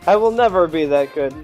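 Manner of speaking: usual speaking voice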